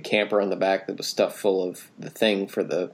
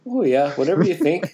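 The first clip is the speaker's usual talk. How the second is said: sarcastic; 7 semitones higher, 3 dB louder than usual. Pretend. Mocking son's arguing.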